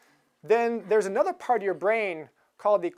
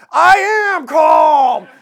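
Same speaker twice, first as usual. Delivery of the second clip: much higher and louder, slow exploding yell; furious shout; 7 semitones higher, 13 dB louder, much slower than usual. Retold or imitated. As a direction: finally snap and bellow "calm". Rage is starting to come out.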